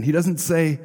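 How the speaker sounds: ordinary talk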